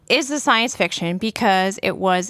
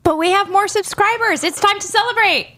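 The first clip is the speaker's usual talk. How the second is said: excited; 12 semitones higher, 3 dB louder than usual. Pretend. Teasing boast about subscribers.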